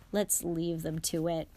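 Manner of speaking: plain reading voice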